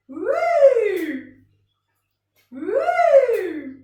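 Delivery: loud, high whoop that rises then falls; swooping woo. Shown as a demonstration. Teacher following a tossed beanbag, loud swooping woo. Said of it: "I'm following the beanbag with my voice".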